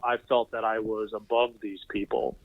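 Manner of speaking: ordinary delivery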